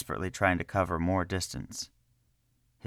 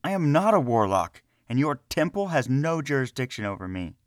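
high, loud defiant protest; angry denial; 7 semitones higher, 4 dB louder than usual. A narrator's reading. Selvin to the guard, refusing the charge, angry and loud. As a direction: hurl the denial back at him. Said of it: "With closed fists".

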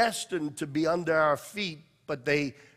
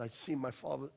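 ordinary talk

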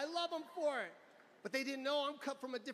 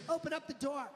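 angry mom demand; 6 semitones higher than usual. Retold or imitated. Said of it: "She walks right up to the front door".

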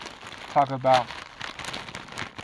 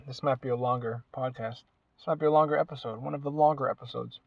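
ordinary talk

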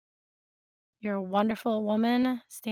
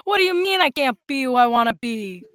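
indignant hammed-up New Yorker. Pretend answer to someone forbidding a dress, indignant New Yorker accent. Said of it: "that very New Yorker".

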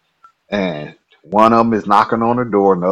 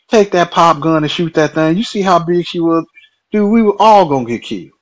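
higher, louder, exasperated disbelief; incredulous yell; 8 semitones higher, 5 dB louder than usual. Retold or imitated. Stub yells at Diamond about the pop gun, incredulous shout. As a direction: shout him down, amazed at the idea.

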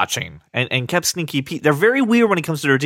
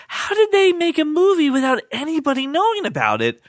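high-pitched mock amazement; incredulous crowd reaction; 11 semitones higher than usual. Host imitating everyone amazed at a secret movie, incredulous. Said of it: "to which everyone goes".

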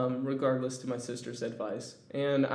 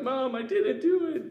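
put-on pleading kid; 12 semitones higher than usual. Crying kid pleading to mom.

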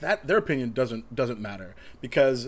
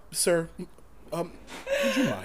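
flight attendant; 3 semitones higher, 4 dB quieter than usual. Retold or imitated. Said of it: "and then".

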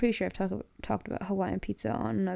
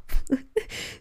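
breathy laugh; 5 semitones higher than usual. Real, not acted. Laughs at losing a point.

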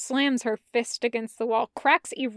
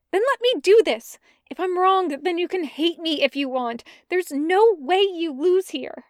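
urgent plea; 6 semitones higher, 5 dB louder than usual. Acted. Jester urging Bren to let her.

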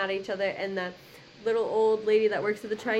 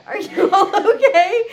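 laughing shout; 8 semitones higher, 13 dB louder, slower than usual. Retold or imitated. Mom checking family after earthquake.

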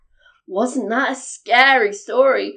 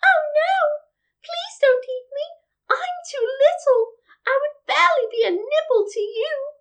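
very high, pleading little goat; small goat voice; 13 semitones higher than usual. A narrator's reading. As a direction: beg in a tiny high voice. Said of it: "with such a small voice".

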